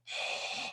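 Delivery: open-mouthed throaty exhale; fogging exhale. Shown as a demonstration. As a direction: breathe out as if fogging a mirror. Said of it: "fog up the mirror".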